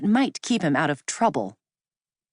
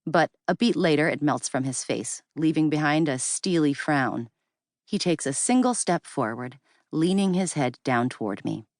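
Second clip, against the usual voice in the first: plain reading voice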